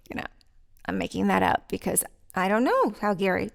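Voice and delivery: ordinary talk, nothing marked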